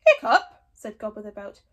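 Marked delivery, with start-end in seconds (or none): 0.1-0.6 loud surprised question; can't believe the name; incredulous. Acted. Gobber reacting in loud disbelief.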